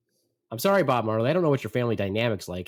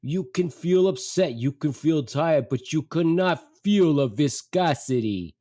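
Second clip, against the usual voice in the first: slow, emphatic put-on dad lecture; unsympathetic dad; 4 semitones higher, 4 dB louder, slower than usual. Dad to greasy-feeling mother, no sympathy, exasperated. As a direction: imitate a father spelling it out, exasperated. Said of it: "my dad is all sympathy".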